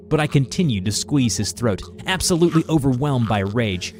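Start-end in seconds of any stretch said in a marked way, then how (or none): none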